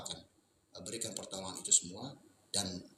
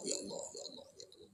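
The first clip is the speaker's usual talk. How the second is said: whispered, murmured call to Allah; hushed zikir; 9 dB quieter, faster than usual. Shown as a demonstration. Teaching listeners to softly call on Allah. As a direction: breathe the name softly, half voiced. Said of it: "Kita sebut zikir kepada Allah".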